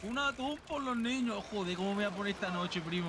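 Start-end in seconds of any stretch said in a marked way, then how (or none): 0.0-1.4 high, slow mock announcement; drawn-out excited call. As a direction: stretch it out high and playful. Joking catch announcement.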